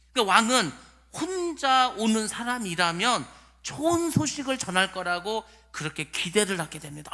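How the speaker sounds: ordinary delivery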